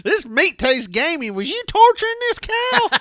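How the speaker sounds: high-pitched, hammed-up accusation; mock indignant customer. Diner asks whether the cow was tortured, mock indignant.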